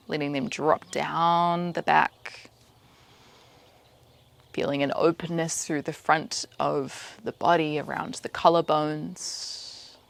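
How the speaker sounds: slow, calm meditation guide with long pauses; measured, even-toned meditation instruction; guided meditation. Acted. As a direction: lead them gently into stillness, unhurried. Leads a meditation.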